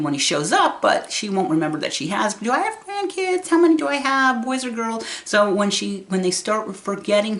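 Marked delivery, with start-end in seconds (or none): 2.3-5.0 much higher, searching questions; lightly voiced puzzled mother; imitating confused mom. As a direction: ask about grandkids, bewildered.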